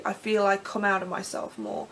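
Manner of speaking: usual speaking voice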